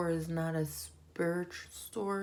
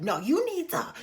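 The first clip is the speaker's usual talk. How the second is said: higher and louder, yelling; retold yell; 10 semitones higher, 7 dB louder than usual. Kenya yelling at Cynthia, loud high yell. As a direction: snap it like a heated yell. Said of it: "yelled at her".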